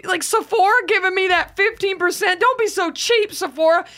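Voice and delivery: high-pitched, exasperated scolding; mock indignant rant. Shopper berating Sephora for stingy discounts, mock indignant. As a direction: plead and scold in playful outrage.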